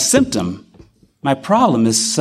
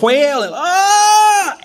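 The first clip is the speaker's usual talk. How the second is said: high, louder wailing cry; retold wail; 3 dB louder, much slower than usual. Telling his wife his fear, mock wailing cry. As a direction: let out a wail. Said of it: "started crying uncontrollably".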